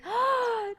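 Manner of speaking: sharp, high intake of breath; big astonished gasp; delighted gasp. Real, not acted. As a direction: gasp at a surprise gift. Reacts to a donation.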